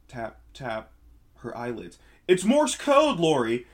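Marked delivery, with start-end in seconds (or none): 2.3-3.7 much higher, louder cry on waking; startled shout. Acted. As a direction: bolt upright shouting the discovery.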